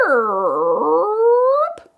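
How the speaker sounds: high squeaky creak; creaky gate noise. Acted. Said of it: "That's a creaky gate".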